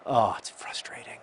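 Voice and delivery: whispered under the breath; frustrated whisper. Real, not acted. Muttered after a bad play.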